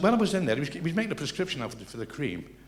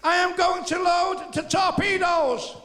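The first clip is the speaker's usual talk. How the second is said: high, loud, slow furious bellow; angry megaphone; 6 dB louder, slower than usual. Acted. Bismarck captain threatening torpedoes, furious through a megaphone. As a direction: threaten them, losing patience.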